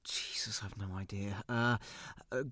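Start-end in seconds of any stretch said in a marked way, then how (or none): 0.0-1.4 whispered curse. Acted. Muttered under breath.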